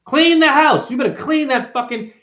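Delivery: sharp loud barked order from a strict mother; mother yelling orders. Retold or imitated. Son voicing his abusive mother's shouted order. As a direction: bark the chore order like an angry mom.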